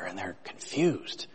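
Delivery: slow, soft whispered aside; a quiet, slowed-down whisper; hushed whisper. Real, not acted. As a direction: lean in and whisper it to the congregation. Aside after quoting Jesus.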